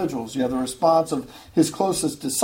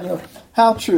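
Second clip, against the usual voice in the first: ordinary talk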